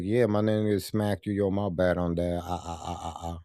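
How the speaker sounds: sheepish mumble fading into nervous laughs; mock sheepish apology. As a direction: act out a limp half-apology. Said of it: "I would've told him to your face".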